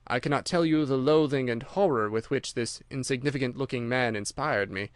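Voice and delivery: plain reading voice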